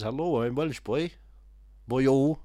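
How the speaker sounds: slow Welsh accent impression; Anthony Hopkins. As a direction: put on a drawn-out, lilting Welsh voice. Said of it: "Anthony Hopkins is Welsh, so he goes like this".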